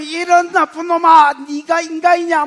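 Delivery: loud scolding outburst; a judge scolding a murderer; angry judge's rebuke. Acted. Judge rebuking a confessed killer, loud and angry. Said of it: "이렇게 하면".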